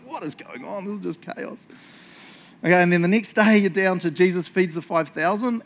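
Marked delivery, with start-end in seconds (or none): 0.0-1.7 hushed, higher-pitched bewilderment; stunned disbelief. Acted. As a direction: drop the volume as if dumbfounded by it all. Acted reader stunned by the chaos.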